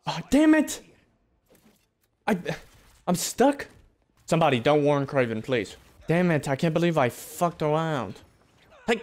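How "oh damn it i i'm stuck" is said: real annoyed outcry; frustrated yelp. Real, not acted. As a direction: burst of frustration. Stuck, frustrated.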